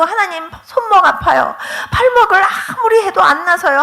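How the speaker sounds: high, whimpering mock cry; imitated tearful plea. Retelling a member praying alone over a sore wrist, tearful. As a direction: act out someone wailing a complaint to God. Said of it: "하나님 앞에 혼자만 울었는데".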